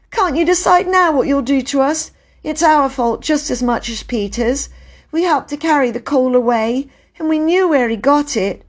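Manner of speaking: put-on high, earnest child's voice; pleading girl. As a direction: plead your brother's case, pitched high. Bobby pleads for her brother.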